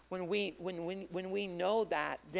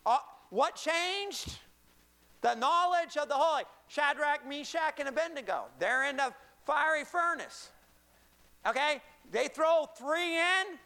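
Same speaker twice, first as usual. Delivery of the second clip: higher-pitched, punchy preacher delivery; high-energy pulpit storytelling; animated preaching; 10 semitones higher, 5 dB louder than usual. Acted. Preaches at full force.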